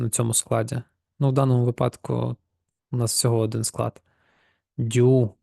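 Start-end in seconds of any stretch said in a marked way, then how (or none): 4.8-5.3 "Дю" with a drawn-out long vowel. Shown as a demonstration. Teacher demonstrates the long vowel to learners, "Дю" held long. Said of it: "довга голосна".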